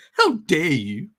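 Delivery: a hint of an outraged teen activist; mock-indignant how dare you; lightly voiced angry schoolgirl; Greta impersonation. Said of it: "my Greta impersonation".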